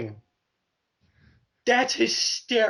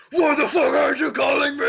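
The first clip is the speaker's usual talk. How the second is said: loud, raised mock yell; Natalia yelling; 4 semitones higher, 6 dB louder than usual. Imagining her yelling at Stephanie McMahon, mock-angry shout. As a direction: yell it like an exasperated caller. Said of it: "her yelling at Stephanie McMahon going".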